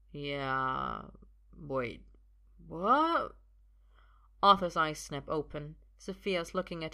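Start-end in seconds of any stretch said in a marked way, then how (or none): 0.1-3.4 low woozy yeah rising to startled what; delayed tipsy double take; dawning confusion. Acted. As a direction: agree drowsily, then catch yourself. Drunk Arthur slowly registers her words.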